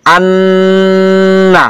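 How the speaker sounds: আন্না with a long, steady hum on ন; held ghunnah. Shown as a demonstration. Teacher demonstrating ghunnah to students, আন্না with prolonged ন. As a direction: hold the ন of আন্না very long, then release. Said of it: "এখানে কিছুক্ষণ সময় দূরে রাখতে হবে".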